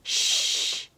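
long voiceless shush; whispered hush. A narrator's reading. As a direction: hush the others with a hiss of breath. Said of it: "Mama Honey Bear whispered".

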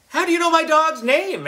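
very high, loud suspicious question; girl calling out. A narrator's reading. Sister calling out to the wizard, high loud girl voice. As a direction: call out like a sharp little sister. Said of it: "Emily called out".

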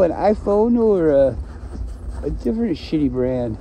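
usual speaking voice

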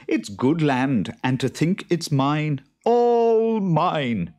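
slow, loud gloating that climbs high; proud farmer swelling with ownership; gloating delight. A narrator's reading. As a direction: savour it, building to a high, loud crow. Farmer gloats over his new land.

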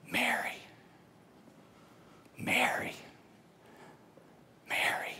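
whispered, tender calling of a name; hushed whisper. Acted. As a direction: breathe each name softly, with long pauses. Jesus calls Mary by name.

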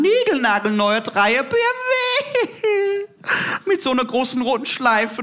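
put-on spoiled girlfriend gushing about her birthday car; spoiled daughter. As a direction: gush like a pampered rich girl. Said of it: "hat's gesagt".

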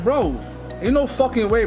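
ordinary talk

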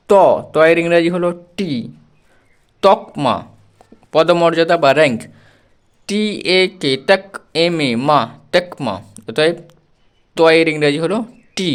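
usual speaking voice

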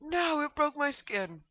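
high, loud mock wail; hammed-up cry of protest. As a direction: wail it in exaggerated dismay, drawn out. Game mishap, wailing in protest.

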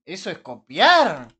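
loud, slow, high "¿Eso es copiar?"; indignant outburst. Real, not acted. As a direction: fire back at the copying claim, outraged. Indignado por la acusación. (indignado y a gritos, despacio)

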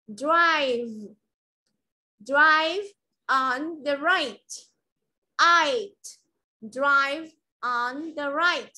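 slow drive on the right, each word clear; teacher drills the sentence for the class; model pronunciation. Shown as a demonstration. Teacher drills the sentence slowly. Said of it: "practice more your pronunciation".

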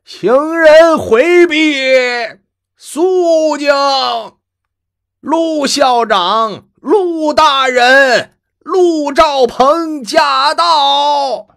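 very high, loud, slow mock official announcement; herald's cry. Grandfather shaming grandson in town, booming herald's cry. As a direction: bellow like a yamen herald clearing the road. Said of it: "突然吆喝起来".

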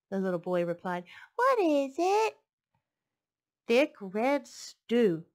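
lively storybook read-aloud; picture book read to a class; reading to children. A narrator's reading. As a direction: read it warmly to little listeners.

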